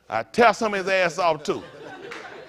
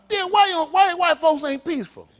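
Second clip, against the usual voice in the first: high, loud pointed question; 9 semitones higher, 4 dB louder than usual. Real, not acted. Comic pointed question to crowd.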